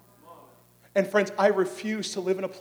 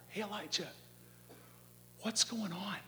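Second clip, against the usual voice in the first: soft, uncondemning check-in; gentle fatherly question; 2 semitones higher, 9 dB quieter than usual. Acted. God as a father checks on Elijah, gentle fatherly question. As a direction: ask softly, as a caring father. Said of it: "It's a question of a father".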